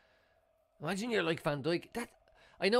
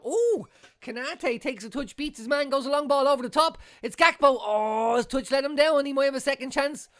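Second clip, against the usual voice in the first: high, loud, rising match call; excited commentary; 9 semitones higher, 5 dB louder than usual. Real, not acted. Fan watching Liverpool, calling the counterattack excitedly. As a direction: call the attack as it builds. Live match, attack building.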